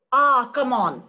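a loud, dismissive brush-off; mock the student's rude scoff; rude retort. Student scoffing loudly at the professor's point. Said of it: "arguing with me in a very rude way".

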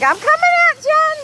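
high, loud playful dog voice; voicing the dog, high and bright; dog's voice. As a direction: speak as the excited dog.

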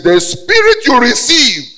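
sudden loud, high proclamation; shouted scripture; full-force preaching. Real, not acted. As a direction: cry the verse out to the congregation.

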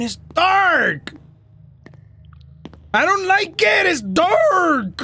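high-pitched, panicky complaint; alarmed wail. Real, not acted. Player hates how dark it is, high scared wail.

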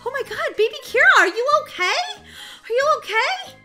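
high, loud, panicked questioning; alarmed concern. Acted. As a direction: rush in frantic over a hurt child. Sitter finds child hurt.

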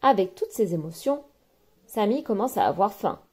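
ordinary delivery

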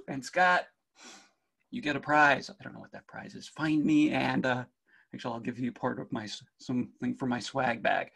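ordinary talk, nothing marked

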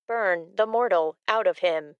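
ordinary delivery, nothing marked

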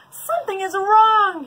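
high, loud alarmed shout. Acted. Keeper runs shouting, loud. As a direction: cry the alarm. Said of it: "he shouted".

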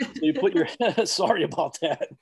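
amused, laughing mid-sentence. Real, not acted. Panelist apologizing for his joke accent, laughing.

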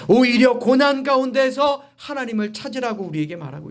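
high-pitched, insistent preacher cadence; emphatic preaching. Real, not acted. Preacher defining true prosperity to congregation, emphatic preaching. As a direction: drive each phrase home from the pulpit.

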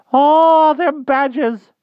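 very high, slow, timid little creature; humble hedgehog voice. Acted. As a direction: squeak it out humbly like a shy hedgehog. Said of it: "said the poor thing to its tummy".

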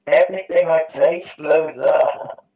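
high, loud and slow complaint; excited. Real, not acted. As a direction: cry out that everything explodes. Everything exploding in game.

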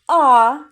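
long, loud "а"; isolated vowel. Shown as a demonstration. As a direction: sound out "а" alone, held and strongly projected. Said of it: "Другий звук який?". (гучний протяжний голосний "а")

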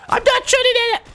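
a hammed-up high squeak; squeaky mock voice. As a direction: squeak it like a cartoon. Squeaky put-on voice.

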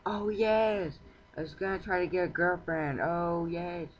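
high, loud delighted whoop; excited cheer. Real, not acted. Gamer cheering at a villager he wants as girlfriend, excited and loud. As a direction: cheer like you just struck gold.

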